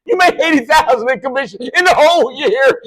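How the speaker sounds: high, loud astonished laughing retort; mock incredulous. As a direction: gasp it out, stunned and amused. Retold incredulous boss laughing.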